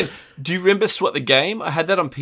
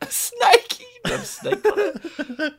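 laughing; slower than usual. Real, not acted. Joking about a snake on the box.

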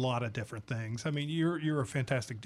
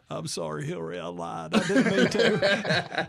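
drawled politician apology, swelling at the end; mock-contrite Southern voice, stretched out; Clinton; 3 dB louder, slower than usual. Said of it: "you sound like Clinton".

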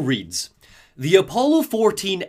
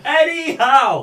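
anyhow drawn out high and louder; high, slow anyhow; 10 semitones higher, 6 dB louder, much slower than usual. Shown as a demonstration. Finally giving the co-hosts the anyhow they asked for. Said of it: "Thank you".

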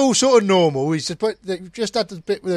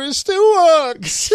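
high, slow, wondering voice; amazed dad; 12 semitones higher, 6 dB louder, much slower than usual. Retold or imitated. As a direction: marvel at it like a broken-down dad. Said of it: "He's like".

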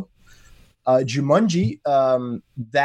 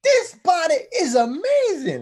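high, excited mock voice, much higher; imitating Fridge in Gillan's body; slower than usual. Retelling Gillan's Fridge delighting in his new body, high mock squeal. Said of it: "she's like".

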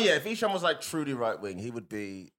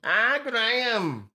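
put-on high, drawn-out Scouse accent; 8 semitones higher, 5 dB louder, much slower than usual. Co-host mourning Graham, in an imitated Scouse accent. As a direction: wail it loud and slow in a Scouse accent.

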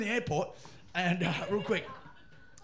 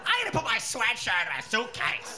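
higher, exaggerated American announcement; [name]; 5 semitones higher than usual. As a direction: blurt the thought out in a mock American accent. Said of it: "Americans just say that shit out".

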